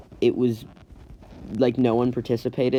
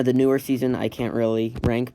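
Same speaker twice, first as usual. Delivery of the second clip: ordinary talk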